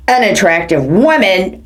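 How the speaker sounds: ordinary talk, nothing marked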